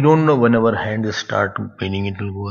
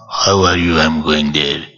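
deep, heavy, lower voice; 7 semitones lower, 4 dB louder than usual. Shown as a demonstration. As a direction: drop into a heavy, booming voice. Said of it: "very, very heavy voice is there".

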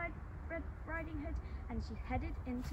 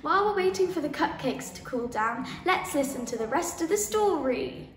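calling out loud; 13 dB louder than usual. Real, not acted. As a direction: call it out to the whole class. Calling out to the class.

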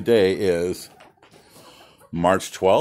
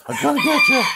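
much higher, louder sing-song threat; playful taunt; 7 dB louder, faster than usual. Pretend. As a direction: tease the rooster in a squeaky voice. Teasing the rooster, repeated.

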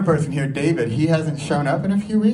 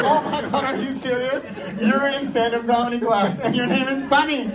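high, laughing "are you serious?"; mock disbelief; 6 semitones higher than usual. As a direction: play up the astonishment with a laugh. Mock disbelief at classmate's name.

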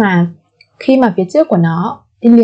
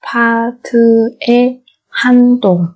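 syllable by syllable. Shown as a demonstration. Teacher models word syllable by syllable.

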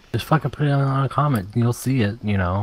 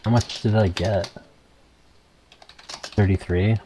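ordinary talk, nothing marked

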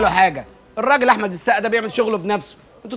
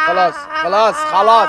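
yelling; 5 semitones higher, 5 dB louder than usual. Acted. Doctor yelling at patient.

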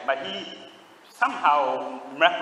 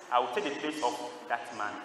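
softer, lower restatement; retold quiet offer; 4 semitones lower, 7 dB quieter than usual. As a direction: imitate a volunteer offering himself quietly and solemnly. Same offer repeated, retold softly.

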